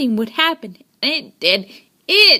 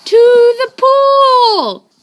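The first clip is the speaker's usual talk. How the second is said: slow, high, loud shout; yelled; 10 semitones higher, 8 dB louder, much slower than usual. A narrator's reading. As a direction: bellow it out across the street. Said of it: "yelled the firemen".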